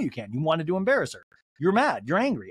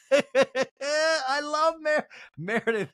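laughing; 10 semitones higher, much slower than usual. Real, not acted.

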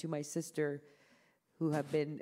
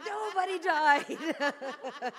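laughing reply; 12 semitones higher, 5 dB louder, much slower than usual. Retold or imitated.

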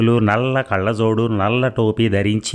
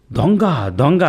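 higher, louder cry of thief; alarmed shout; 6 semitones higher, 3 dB louder, slower than usual. Acted. Husband yelling to his wife that a thief is here, alarmed shout.

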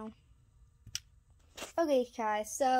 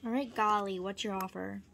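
pretend buyer voice; 5 semitones higher, 6 dB louder than usual. Kid voicing fake buyer.

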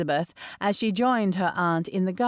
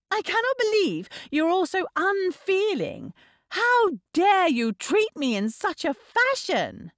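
put-on very high, indignant girl; outraged tantrum; 12 semitones higher than usual. A narrator's reading. Young sister scolding her unfeeling family, outraged tantrum. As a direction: rail at them, deeply affronted.

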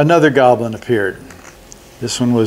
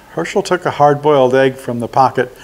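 plain reading voice

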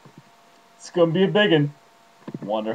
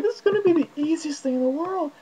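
high, airy, carefree inner voice; giddy inner voice; 10 semitones higher, 6 dB quieter than usual. Pretend. His mind cheering him on, high and giddy. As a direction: sound blissfully sure it'll be easy. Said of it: "my mind's got me all, like, happy".

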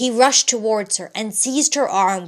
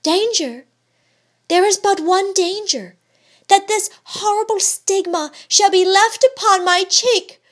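much higher and louder, desperate; cry; 10 semitones higher, 7 dB louder than usual. A narrator's reading. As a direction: burst out in anguish over the mark. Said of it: "cried Georgiana".

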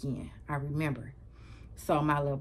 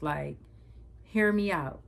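usual speaking voice

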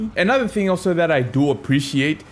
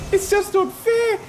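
pitched-up whining complaint, much higher; higher mock whine. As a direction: whine it like a hammed-up child.

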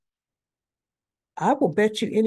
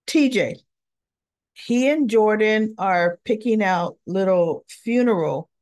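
plain reading voice

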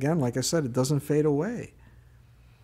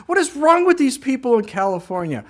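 much higher, louder, stretched disbelief; alarmed disbelief; 11 semitones higher, 5 dB louder than usual. Retold or imitated. Pastor retelling his shock at California drivers, high and loud. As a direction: cry out in disbelief at the speed. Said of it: "I'm like".

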